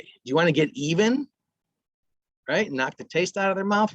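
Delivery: ordinary delivery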